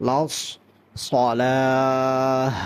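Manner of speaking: সলাহ with heavy s, held long; slow, level সলাহ demonstration; thick sad. Shown as a demonstration. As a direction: round the লস and stretch সলাহ. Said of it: "সদটা উচ্চারণ করতে হবে".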